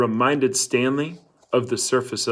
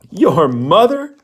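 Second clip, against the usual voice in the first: loud, scoffing and slow; mocking laugh; 6 semitones higher, 8 dB louder, slower than usual. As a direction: laugh the words at him in disbelief. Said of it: "laughed Squid".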